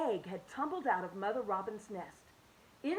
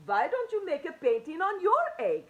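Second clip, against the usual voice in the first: friendly painter; 7 semitones higher, 7 dB louder than usual. Acted. Hans offers painting idea.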